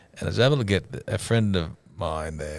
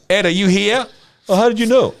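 mock banter voice; 7 dB louder than usual. Retold banter, acted quotes.

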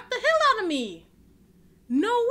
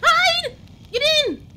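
urgent shouting; 9 semitones higher, 5 dB louder, slower than usual. Real, not acted. Fleeing monster.

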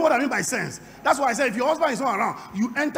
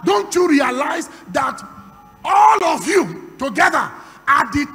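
full-force preaching; 3 semitones higher, 11 dB louder than usual. Real, not acted. Preacher asking who lives inside them, booming call-and-response. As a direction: proclaim each phrase loudly to rouse the congregation.

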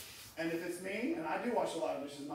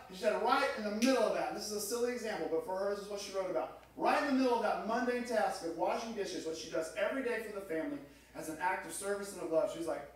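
ordinary talk

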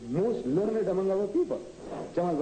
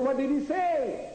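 mock interviewer; 8 semitones higher, slower than usual. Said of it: "He said".

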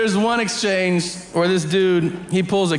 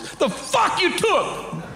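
much higher, slow, drawn-out laughing demand; mock prisoner demand; 13 semitones higher, slower than usual. Comedian quoting the prisoner asking the kid, slow high laughing voice. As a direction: stretch out the prisoner's question, laughing. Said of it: "he goes".

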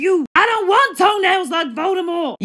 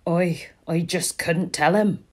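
whisper; 11 semitones lower, 6 dB quieter, slower than usual. Acted. Guilty confession to a friend.

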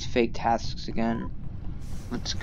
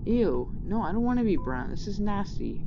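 disgusted; 6 semitones higher, 4 dB quieter than usual. Real, not acted. Disgusted at getting brown color.

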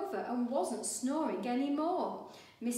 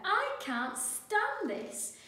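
high, slow, exasperated complaint; fed up; 10 semitones higher, 6 dB louder, much slower than usual. A narrator's reading. Mister Bear kept awake, slow fed-up complaint. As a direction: drag it out, at the end of your rope. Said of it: "said Mister Bear".

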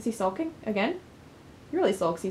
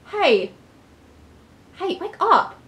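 calling out; 7 semitones higher, 6 dB louder, much slower than usual. Acted. Friend waking her from nightmare.

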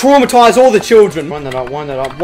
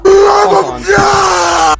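mocking shout; 12 semitones higher, 5 dB louder than usual. Real, not acted. Mocking the game's announcement.

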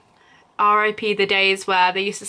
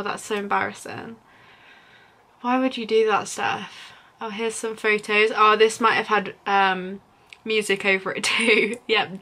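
ordinary delivery

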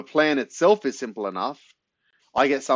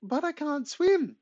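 put-on small boy; slower than usual. A narrator's reading. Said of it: "says Corey".

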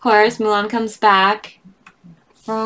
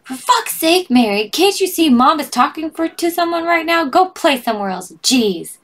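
higher, fed-up scolding; annoyed mom; 8 semitones higher, 3 dB louder than usual. Acted. A mother telling her daughter to go play, annoyed. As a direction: scold the kid, out of patience.

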